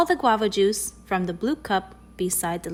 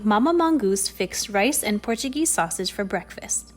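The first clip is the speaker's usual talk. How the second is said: ordinary delivery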